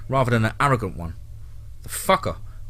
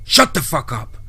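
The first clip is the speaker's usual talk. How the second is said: angry, raised-pitch order; angry outburst; 4 semitones higher, 3 dB louder than usual. A narrator's reading. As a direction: snap angrily to silence him.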